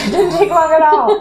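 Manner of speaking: high, loud laughing words. Real, not acted. Therapist joking with client over quick tears, laughing. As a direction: laugh your way through it.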